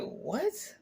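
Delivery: very high, rising disbelieving what; stunned what. Retold or imitated. Woman hearing she can be roommate, high shocked what. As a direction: squeak it out in pure disbelief.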